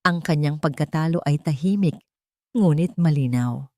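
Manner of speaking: plain reading voice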